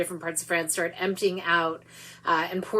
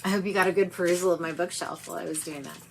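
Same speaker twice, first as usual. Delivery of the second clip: normal talking voice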